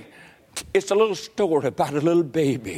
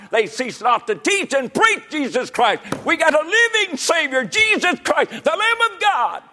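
high-pitched revival preacher's cry; fired-up preaching; 11 semitones higher than usual. Real, not acted. Preacher proclaiming a living Savior, high full-force preaching. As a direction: build to an exultant proclamation.

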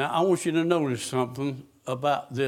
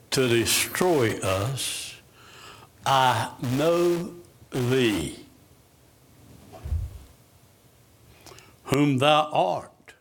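slow, dragged-out spirit voice, half-acted; lightly voiced unclean spirit, slow and wary; slower than usual. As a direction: draw each word out like a cornered demon.

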